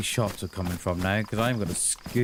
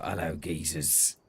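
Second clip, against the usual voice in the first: low, slow and softer "Hello, geezers" greeting; Slan's voice; 8 semitones lower, 7 dB quieter than usual. Retold or imitated. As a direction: drop low and slow, a mild take on Slan's nice voice. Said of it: "that very nice voice he's got".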